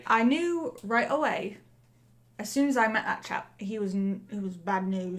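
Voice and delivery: put-on chatty Cockney accent; Cockney accent, in-character interview; Cockney lamplighter. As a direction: talk as a musing Cockney lamplighter.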